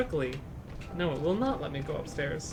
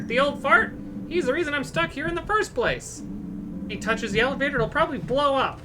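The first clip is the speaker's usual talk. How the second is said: lightly voiced patients and staff, raised pitch; voiced game characters; 6 semitones higher than usual. Streamer reading game dialogue aloud, lightly character-voiced. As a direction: read each hospital character in a higher put-on voice.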